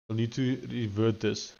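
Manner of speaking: normal talking voice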